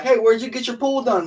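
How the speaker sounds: high, loud impression of a rich customer; rich client voice. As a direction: play an eager rich customer. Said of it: "they gonna be like".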